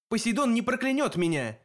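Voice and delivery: defiant retort. A narrator's reading. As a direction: throw it back defiantly, pitch up. Hero retorts to the cyclops.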